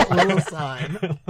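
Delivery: laughing through the name. Real, not acted. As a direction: giggle the words out slowly. Laughing while repeating name.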